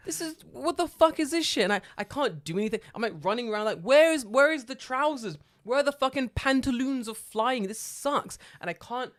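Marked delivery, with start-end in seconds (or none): 0.1-1.6 high, exasperated mock outburst; baffled outburst. Gamer stuck on a boat, high baffled outburst. Quoting confusion in game. 3.8-7.6 high, loud and slow mock panic; frantic search. Gamer searching for flying pants, high mock panic.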